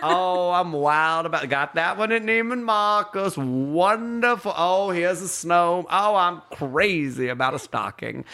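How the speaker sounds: high, slow, gushing grandmother; Swoopy announcing. Retold or imitated. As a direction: exclaim over each item in long stretched vowels. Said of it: "She'd announce them".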